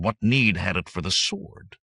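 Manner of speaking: plain reading voice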